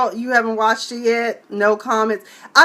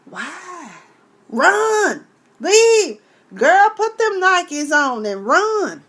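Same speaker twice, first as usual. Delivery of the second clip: urgent advice; 8 semitones higher, 3 dB louder, much slower than usual. Acted. Urging a woman to leave.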